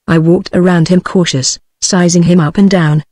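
plain reading voice

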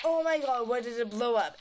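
mild mock alarm; mock shocked. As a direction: act out a hammed-up shocked gasp of words. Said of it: "And they'll be shocked".

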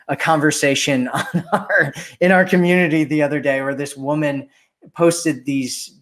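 ordinary talk, nothing marked

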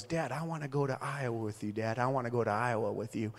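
soft imitated small-boy voice; little boy pleading. Five-year-old boy asking Dad to bring him along, little boy pleading. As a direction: imitate a small son begging quietly.

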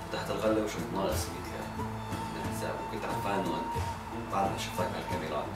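low, soft, gentle voice; calm forgiveness. Acted. Mohammad gently forgiving his friend, soft and low. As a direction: reassure him quietly that all is forgiven.

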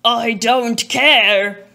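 loud, slow lion voice, unlike her own; lion's reply. Acted. Lion answering the frantic mother, loud and slow. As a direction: let the lion drag out the refusal loud. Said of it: "The lion answered".

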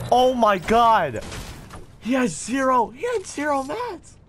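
very high, stunned squeal; shrieking jackpot winner. Real, not acted. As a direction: shriek in disbelief. Player has zero materials.

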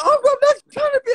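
high, tearful shouting plea; crying shout. Real, not acted. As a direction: yell it through tears, begging to be believed. Drunk chaos on live stream.

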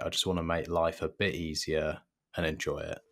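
ordinary talk, nothing marked

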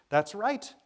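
loud, high, cutting interjection; strident call. A narrator's reading. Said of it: "called a strident voice".

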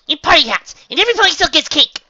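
very high, loud, gleeful chirp; bubbly excitement. Acted. Pinkie adds cake to Luna's plan, squealing excitement. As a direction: squeal it like a party announcement.

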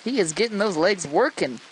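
ordinary talk, nothing marked

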